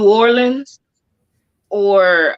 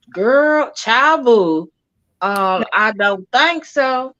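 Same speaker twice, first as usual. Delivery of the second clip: put-on high, drawn-out East Texas accent; 4 semitones higher, much slower than usual. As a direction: lay on the comfortable family East Texas twang. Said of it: "I do sound different".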